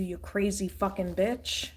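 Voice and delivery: ordinary delivery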